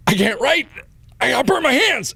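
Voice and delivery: high, pained protest; exasperated wail. Retold or imitated. Burnt patient handed a form, high exasperated wail. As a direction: protest in a mock-agonized cry.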